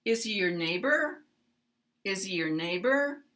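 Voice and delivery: Is he your neighbor rising on neighbor; uptalk. Shown as a demonstration. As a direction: lift the pitch on neighbor at the end. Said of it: "rising intonation at the end".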